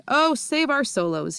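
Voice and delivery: distressed giant crying out; anguished cry. Acted. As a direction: wail it out in despair. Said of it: "he cried".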